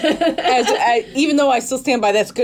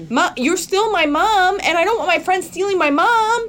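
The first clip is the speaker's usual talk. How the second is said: mock protest; 7 semitones higher than usual. Retelling a daughter's complaint to her mom, mock protest. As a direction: protest it in a higher voice.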